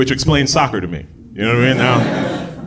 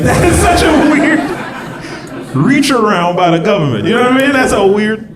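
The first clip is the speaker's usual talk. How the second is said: laughing outburst; 13 semitones higher, 6 dB louder than usual. Real, not acted.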